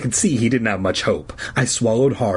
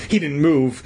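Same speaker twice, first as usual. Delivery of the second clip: usual speaking voice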